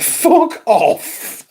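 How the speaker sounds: high-pitched, drawn-out incredulous exclamation; retold disbelief. Retelling a reply to a mate's shocking claim, high disbelief.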